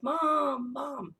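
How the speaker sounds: put-on high, drawn-out child's call; calling for mom. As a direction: imitate a lost kid calling out for mom.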